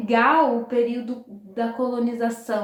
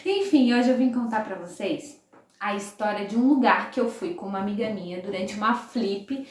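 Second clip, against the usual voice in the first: ordinary delivery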